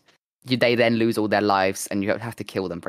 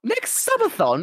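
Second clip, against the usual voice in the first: high, drawn-out disbelieving echo; incredulous; 14 semitones higher, slower than usual. Real, not acted. Streamer balks at friend's offer to wait, incredulous. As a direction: repeat it back in high-pitched disbelief. Balking at waiting till next subathon.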